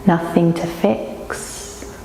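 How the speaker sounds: slow, unhurried yoga nidra guiding voice; guided meditation. Real, not acted. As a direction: lead them slowly into rest, with long pauses. Leads yoga nidra relaxation.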